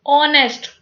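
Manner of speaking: "ऑनेस्ट" begun straight on the o vowel; silent H. Shown as a demonstration. Teacher showing the silent H, "ऑनेस्ट" starting on o. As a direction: say "ऑनेस्ट" with no h at the front. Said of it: "हम इसको ओ से ही start करते हैं".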